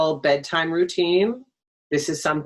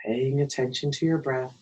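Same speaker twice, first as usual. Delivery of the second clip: guided meditation. Acted. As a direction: guide them gently into stillness, phrase by phrase. Leads a breathing meditation.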